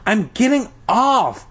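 high-pitched call to a parent. A narrator's reading. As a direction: call it out, mildly exasperated, pitch up. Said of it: "heard her call to her father".

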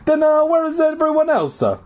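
very high cartoon Italian, vowels tacked on; bad Italian accent. A narrator's reading. Janitor questions the kids, exaggerated Italian accent. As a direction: add an Italian "a" to word endings. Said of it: "adding an A to the end of each word".

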